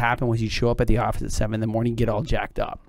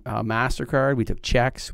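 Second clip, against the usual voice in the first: usual speaking voice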